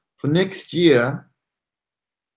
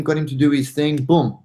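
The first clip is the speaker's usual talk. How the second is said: ordinary talk, nothing marked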